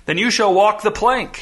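high-pitched mild shout; shouted threat. A narrator's reading. Crewman condemns the captain to the plank, shouting. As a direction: call out a sentence on the prisoner. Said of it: "shouted a stubby, horribly sunburned man".

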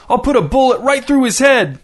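shouting at the police from the porch. A narrator's reading. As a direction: yell it out the window at the cops. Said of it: "shouted Ray".

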